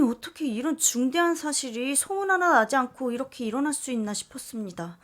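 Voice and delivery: ordinary delivery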